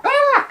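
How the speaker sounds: very high loud shriek as it goes off; high pitched scream. Retold or imitated. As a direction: shriek and duck. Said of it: "I was like".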